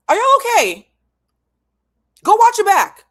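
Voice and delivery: high, loud outburst; exasperated shout. Real, not acted. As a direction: yell it at them in disbelief. Outraged calls to the audience.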